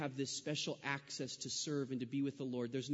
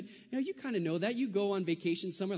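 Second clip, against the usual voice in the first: ordinary delivery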